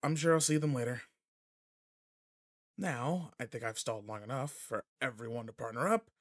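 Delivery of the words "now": ordinary talk, nothing marked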